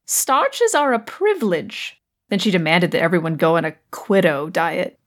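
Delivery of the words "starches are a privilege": high, drawn-out quoted voice; Evelyn. Retold or imitated. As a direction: mimic a housemate's decree, pitched up and slow. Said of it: "but Evelyn says".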